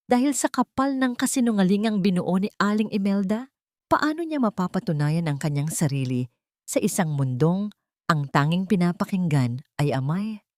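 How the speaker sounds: ordinary delivery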